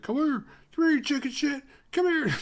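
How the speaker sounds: hammed-up taunting call. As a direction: bait him from afar, rising. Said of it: "Taunting him".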